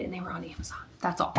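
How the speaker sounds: soft unvoiced whisper. Real, not acted. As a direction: whisper it like a little secret. Playful aside about Amazon.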